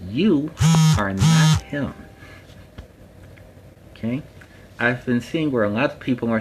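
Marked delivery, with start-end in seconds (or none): 0.1-1.9 YOU and NOT hit hard and loud, pitched up; stressed warning. Real, not acted. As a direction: drive home that you are not him. Emphatic warning to viewers.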